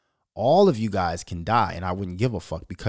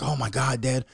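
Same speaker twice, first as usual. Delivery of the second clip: ordinary talk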